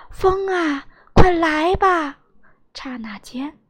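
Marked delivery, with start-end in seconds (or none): 0.1-2.2 loud, higher storybook shout; raised, commanding cry to the sky; loud call. A narrator's reading. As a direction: call out to summon the wind. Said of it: "大声喊道".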